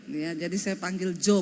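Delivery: ordinary talk, nothing marked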